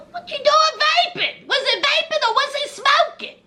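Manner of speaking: high-pitched incredulous questioning; mock disbelief. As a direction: ask it way up high, as if you can't believe it.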